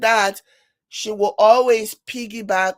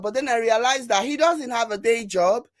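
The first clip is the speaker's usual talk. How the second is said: ordinary talk, nothing marked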